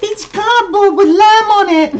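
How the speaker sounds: put-on loud, very high falsetto child, botched Scottish; bad Scottish accent. Child revealing the cardboard-and-lamb snack, loud mock Scottish falsetto. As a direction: shriek it slowly in a hammed-up Scottish squeal. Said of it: "that was supposed to be Scottish".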